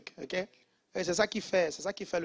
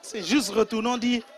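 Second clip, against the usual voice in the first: ordinary talk, nothing marked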